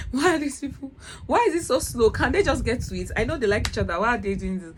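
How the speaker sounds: loud, high-pitched exasperated rant; very impatient. Retold or imitated. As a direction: complain to a friend, loudly begging the story to hurry up. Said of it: "I was getting very impatient".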